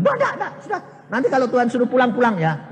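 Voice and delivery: high, irritated refusal; annoyed no. Retold or imitated. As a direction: snap it high like an annoyed sister. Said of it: "Jengkel, dia marah". (kakak menolak dengan jengkel)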